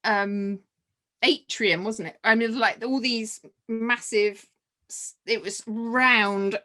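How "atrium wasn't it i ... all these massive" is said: normal talking voice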